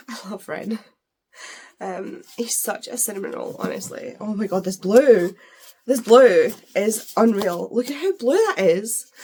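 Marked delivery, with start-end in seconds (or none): 0.1-0.8 soft swooning whisper; whispered love. Real, not acted. Fangirling over a book character. 4.2-9.1 excited gushing. Real, not acted. Gushing over the cover colour.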